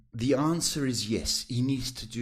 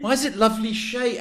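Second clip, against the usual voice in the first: high, loud, quick complaint; playful jealous protest; mock indignation; 11 semitones higher, 6 dB louder, faster than usual. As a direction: pretend to be offended. Mock protest at being left out.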